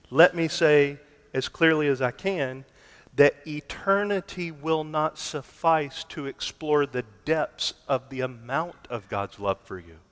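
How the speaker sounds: ordinary talk, nothing marked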